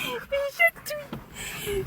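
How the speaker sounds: being tickled. Real, not acted. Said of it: "Je suis chatouillée".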